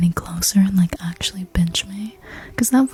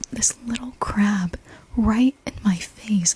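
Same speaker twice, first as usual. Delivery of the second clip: ordinary talk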